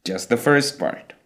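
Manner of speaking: normal talking voice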